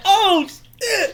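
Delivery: mock yelp of pain; high, loud comic ouch; Chris Tucker. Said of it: "Chris Tucker hit himself".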